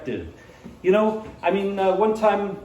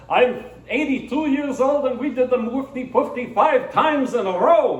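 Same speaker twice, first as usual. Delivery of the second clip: put-on Borscht Belt accent; 7 semitones higher, 3 dB louder than usual. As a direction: brag excitedly in a singsong Borscht Belt accent. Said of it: "Borscht Belt accent".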